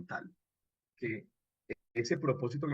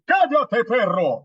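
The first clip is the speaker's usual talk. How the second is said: loud, high, harsh command; imposing shout; 9 semitones higher, 15 dB louder, slower than usual. Shown as a demonstration. Radio teacher demos an imposing character shouting at the dog. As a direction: bark it down from above. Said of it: "es más imponente".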